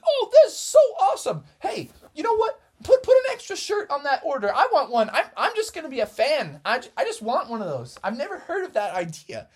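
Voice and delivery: high, fast gushing factory worker, laughing at the start; excited fan. Acted. Factory worker raving about the elf shirt, gushing. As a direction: gush over the shirt like a starstruck fan.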